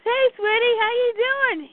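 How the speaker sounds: high acted sweet greeting; easy, high-pitched how-are-you; fond greeting. Fond check on Ivy.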